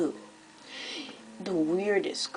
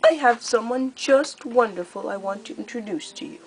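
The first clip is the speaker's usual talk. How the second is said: high, louder, theatrically sweet menace; gleeful cruel queen; 7 semitones higher, 6 dB louder than usual. Acted. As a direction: purr out the threat like a delighted tyrant. Scarlet introduces surprise.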